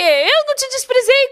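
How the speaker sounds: high, loud mock protest from a wife; indignant denial. Wives answering an accusing husband, indignant denial.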